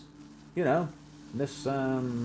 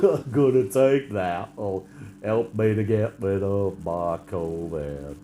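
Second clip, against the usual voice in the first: slow, dim-witted drawl, a bit louder; dopey man voice; 4 semitones lower, 4 dB louder, much slower than usual. Retold or imitated. Mocking the man who drank the fish tank cleaner, slow and dim. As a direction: play the fool who believes it.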